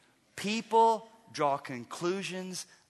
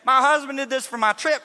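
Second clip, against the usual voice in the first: proud wife bragging; 8 semitones higher, 12 dB louder than usual. Pretend. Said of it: "She's gonna say".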